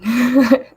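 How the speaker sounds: short bright burst of laughter; amused little laugh; light laugh. Real, not acted. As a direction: laugh lightly at your own joke. Laughs at her own joke.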